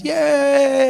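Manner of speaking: long high "yay"; gleeful kid's cheer. Acted. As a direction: cheer like a kid running with a ball. Kid comes running with the ball.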